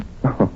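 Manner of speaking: a quick, soft chuckle; short chuckle. Acted. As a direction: laugh it off lightly. Amused at her remark.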